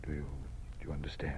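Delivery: soft pressing whisper. Acted. Dean making sure the teacher understood, whispered. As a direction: whisper it, checking she's got it. Dean ends private warning.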